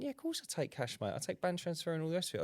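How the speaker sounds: exasperated retort. Retold or imitated. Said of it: "I went".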